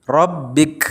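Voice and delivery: Robbik, short vowel, hard stop on k. Shown as a demonstration. Teacher modelling the short Robbik at a pause, clearly. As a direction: clip Robbik short, no lengthening. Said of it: "Tidak panjang, tapi pendek".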